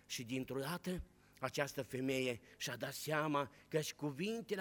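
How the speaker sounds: hushed sermon narration of Mary at the tomb; hushed storytelling. A narrator's reading. Hushed retelling of the tomb scene.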